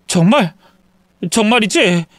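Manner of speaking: high, needy questions; worried son double-checking; anxious checking. Acted. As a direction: ask for reassurance, voice climbing. Son seeks reassurance from mother.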